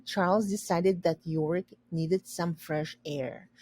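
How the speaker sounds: ordinary delivery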